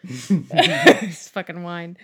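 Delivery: laughing. Real, not acted. Laughs at own burping.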